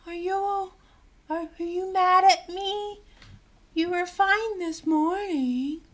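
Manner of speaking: high, slow mock-innocent whine; mocking Amber again. Vlogger parodying Amber's question to Johnny, high whiny mock. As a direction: parody her sugary fake hurt. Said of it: "Not this whole".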